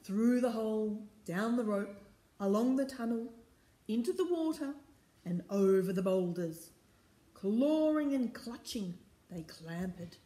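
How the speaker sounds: rhythmic, emphatic storybook reading; story narration. A narrator's reading. Reader takes the crew down the rope, story narration. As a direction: chant the refrain clearly for little listeners.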